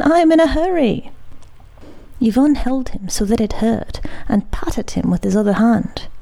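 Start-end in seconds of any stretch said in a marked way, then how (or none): 0.0-1.1 high, fast and pressing; boy begging the sailor to let him go; urgent cry. A narrator's reading. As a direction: rush it out, desperate to leave. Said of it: "cried Simon".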